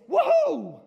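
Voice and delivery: high, loud joyful whoop; excited woohoo. Pretend. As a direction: whoop with pure joy. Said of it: "maybe God's like this".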